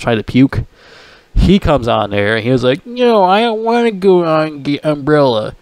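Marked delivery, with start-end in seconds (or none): 2.9-5.5 put-on higher, slow childish whine; whiny childish voice. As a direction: whine like a five-year-old refusing. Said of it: "this is how they're acting on the show".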